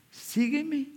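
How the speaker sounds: Jesus calling. Acted. Jesus calling Simon to follow him, high call.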